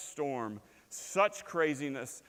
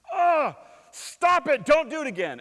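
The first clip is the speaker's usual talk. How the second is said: pained yell; 12 semitones higher, 12 dB louder than usual. Pretend. Man yelling at hammer after crushing thumb, pained yell. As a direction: yell at the hammer through the hurt.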